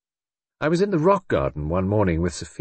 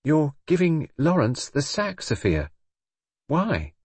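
higher, abrupt questioning; abrupt challenge; 7 semitones higher, slower than usual. A narrator's reading. Brenda confronting Sophia about Lawrence's sacking, abrupt. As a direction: put the question to her abruptly. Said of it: "Then she said abruptly".

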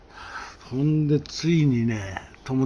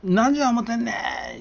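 much higher, louder, slow yell; furious retold yell; angry shout; 11 semitones higher, 4 dB louder, slower than usual. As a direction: bang on the door and bellow at the neighbor. Friend bangs on door shouting.

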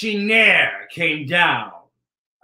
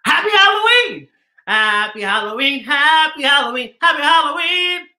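high, louder festive calls; cheerful greeting; 10 semitones higher, 4 dB louder than usual. Real, not acted. Cheerful holiday sign-off.

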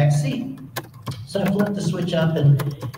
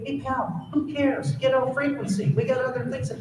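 dismissive controller; 6 semitones higher than usual. Retold or imitated. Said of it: "Center would like to say".

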